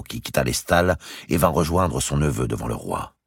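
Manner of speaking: ordinary delivery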